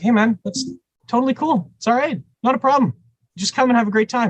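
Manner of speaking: high, light mock-breezy "no problem" voice; mock breezy reassurance. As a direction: play it way up, bright and easygoing. Reassuring the bassist, retold.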